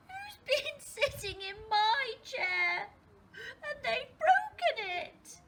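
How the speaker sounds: tiny high voice, loud and slow; high-pitched, drawn-out little bear cry; Baby Bear. Acted. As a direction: wail it like an upset little cub. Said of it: "in a tiny little voice".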